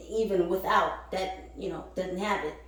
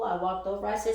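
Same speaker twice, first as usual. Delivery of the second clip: usual speaking voice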